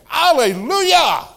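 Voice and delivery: full-throated, high, joyful shout; loud, slow, exultant hallelujah. Acted. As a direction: throw it out like a victory cry. Bishop's joyful answer.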